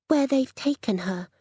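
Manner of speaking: lightly voiced young child on the phone; small young voice. A narrator's reading. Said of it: "small and young".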